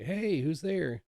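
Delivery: high, drawn-out call to the door. Retold or imitated. Calling to the door again.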